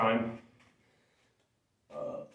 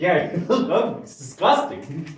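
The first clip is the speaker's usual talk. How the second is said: louder, higher mocking laugh-through; laughing taunt; 7 semitones higher, 6 dB louder than usual. Real, not acted. Teasing her brother on camera, laughing mockery. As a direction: tease him, laughing at the words.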